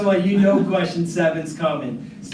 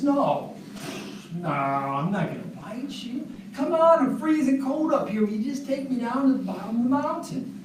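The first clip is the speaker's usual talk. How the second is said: higher wheedling snake; coaxing snake; 8 semitones higher than usual. Acted. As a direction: sweet-talk the farmer into it. Snake coaxes the farmer.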